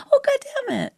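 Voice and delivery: high, light mock curse; softened retelling. Wife mocks how husband changes his story, high and softened. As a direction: mimic a cleaned-up, harmless version of the curse. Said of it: "You always change it".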